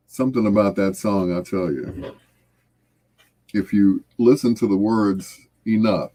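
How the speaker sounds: normal talking voice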